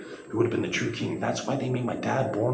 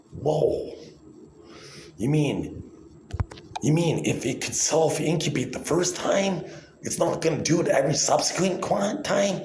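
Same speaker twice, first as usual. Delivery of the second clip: mock amazement; 8 semitones higher than usual. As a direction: act stunned by the obvious.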